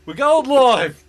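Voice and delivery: loud and excited; loud announcement. Real, not acted. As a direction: announce it loudly. Announcing going live, loud.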